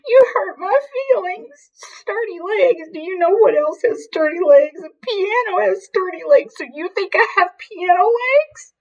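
very high, hammed-up tearful wailing; theatrical wail. A narrator's reading. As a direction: wail like a deeply wounded drama queen. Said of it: "she would wail".

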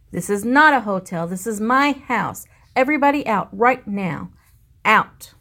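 loud, rising to a fast furious shout; angry bellow. Acted. Bruce ordering every guest out of his house, angry shout. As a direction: throw them all out at full volume. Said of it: "Bruce lost his temper".

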